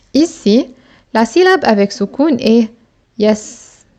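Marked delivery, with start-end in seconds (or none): none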